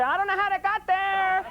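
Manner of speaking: put-on high, slow little-girl voice, all innocence; six-year-old fibbing. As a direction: play a small girl caught out, pitched well up. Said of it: "even for a six-year-old to say".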